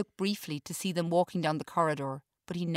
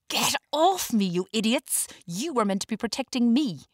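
loud furious yell at the dogs; outraged shout; screaming; 6 semitones higher, 5 dB louder than usual. A narrator's reading. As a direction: scream it, pinned to the ground. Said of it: "Walter screams".

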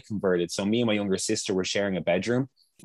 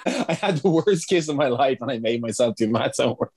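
laughing; 5 semitones higher than usual. Real, not acted. Laughing while telling.

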